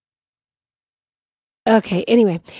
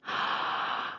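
shocked gasp; 14 dB quieter than usual. Real, not acted. Reacts to hearing sauce choice.

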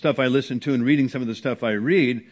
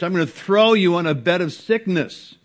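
stern warning; 6 semitones higher, 6 dB louder than usual. Acted. Quoting Jesus to the churches.

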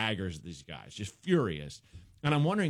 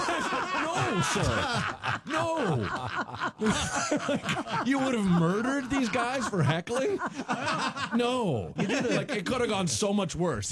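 laughing disbelief; 11 semitones higher, slower than usual. Real, not acted.

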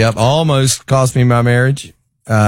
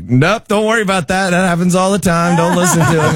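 higher, quick brushing-off nurse voice; a lightly voiced nurse, higher and fast; brisk nurse; 7 semitones higher, faster than usual. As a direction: breezily wave it away like a busy nurse. Nurse brushing off patient's remark.

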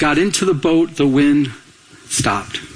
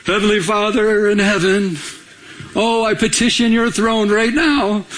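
mock formal prayer; 3 semitones higher than usual. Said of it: "He didn't have time for that prayer".

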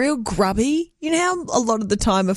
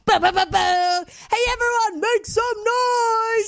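DJ hype voice; 11 semitones higher than usual. Pretend. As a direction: stretch it out like a club DJ. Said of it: "we need to see what it sounds like".

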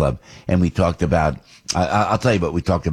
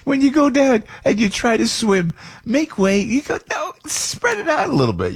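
high mock plea. Mock crowd at the beach.

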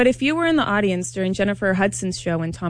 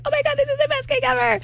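gushing. Retold or imitated. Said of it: "they also were like".

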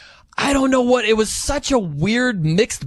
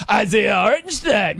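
arena announcer impression; 3 semitones higher than usual. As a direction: belt the name like a club announcer. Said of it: "I'm this crazy person".

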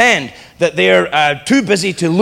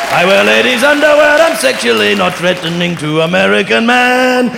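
hammed-up, higher-pitched English caricature; terrible English accent; 4 semitones higher, 4 dB louder than usual. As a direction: put on an absurdly exaggerated English voice. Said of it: "that is the worst English accent".

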